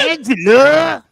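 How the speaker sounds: loud, high, drawn-out impression holler; big sitcom-style call; Tony Danza. Shown as a demonstration. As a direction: bellow the name slowly as a hammed-up impression. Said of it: "I could do a really good Tony Danza".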